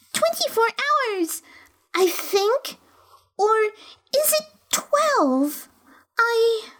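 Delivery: put-on high, slow, doubtful girl voice; unsure young girl. A narrator's reading. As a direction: wonder aloud, losing confidence. Alice unsure.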